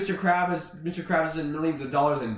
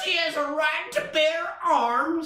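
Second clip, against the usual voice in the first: mock proclamation; 11 semitones higher than usual. Storyteller justifies SpongeBob's firearms, high mock proclamation.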